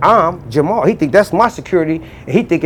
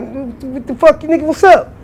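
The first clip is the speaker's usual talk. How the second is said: high, startled what's-up; shocked to see him out; surprised greeting; 10 semitones higher, slower than usual. Retold or imitated. To an old friend fresh out of jail, startled and high.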